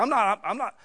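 loud, high furious telling-off; retold angry yell. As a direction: shout him down for cheating a grieving widow. Retold confrontation with salesman.

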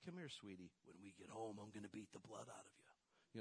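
sweet on the surface, soft and menacing. Retold or imitated. Dad to his daughter, a soft sugary threat.

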